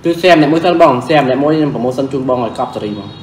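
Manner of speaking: usual speaking voice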